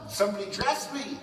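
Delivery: ordinary talk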